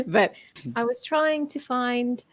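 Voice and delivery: put-on a halting French accent as the bound captive Viola; Viola's lines in an attempted French accent, slow. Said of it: "trying to do a French accent".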